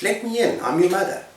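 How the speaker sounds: high put-on mother voice; wolf posing as mother. Wolf asks the kids to let him in, high fake-mother voice. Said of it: "and the wolf says".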